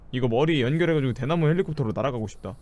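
ordinary delivery

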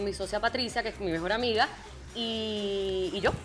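normal talking voice

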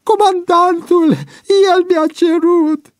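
very high, loud desperate pleading; tearful wail. A narrator's reading. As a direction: blame another through a wailing cry. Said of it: "izbucni Boian în plâns". (vaiet plângăreț, disperat)

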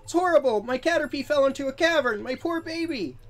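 high, loud panicked plea. Acted. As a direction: cry out like a frantic parent. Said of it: "He's panicking".

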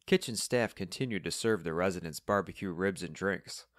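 plain reading voice

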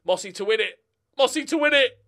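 building from eager to a loud, high call; rising excitement. Real, not acted. Commentating winning chance.

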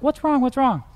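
put-on a mother checking on her frightened child; worried mom. Mom asks her hiding son what's wrong, high and concerned. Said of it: "and Mom's like".

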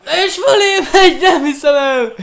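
loud, high laughing outcry; laughing disbelief. Real, not acted. Shot goes over the bar.

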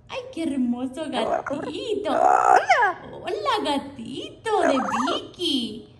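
ordinary talk

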